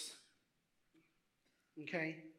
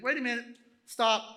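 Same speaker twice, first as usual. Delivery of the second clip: loud, raised command to stop; sudden halt; 4 semitones higher, 9 dB louder than usual. Acted. God stopping the image-making factory line, commanding. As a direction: cut in abruptly to halt everything.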